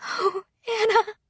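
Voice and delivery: high, slow, crying lament; sobbing. Acted. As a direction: grieve over her lost friend. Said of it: "Tears streamed down Marilis's face".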